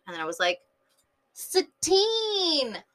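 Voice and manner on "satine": high, drawn-out squeal of a name; delighted exclamation. Retold or imitated. Vlogger landing on a favourite name, delighted exclamation.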